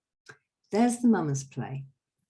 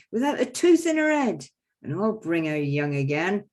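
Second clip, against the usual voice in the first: the quack doctor boasting; 3 semitones higher, 6 dB louder than usual. Acted. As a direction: brag to the crowd like a travelling quack. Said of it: "So I go".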